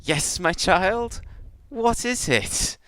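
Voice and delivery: high, drawn-out monster queen voice; Hive Queen. Acted. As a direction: answer her drone slowly in a high queenly voice. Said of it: "looks down at this little ... and goes".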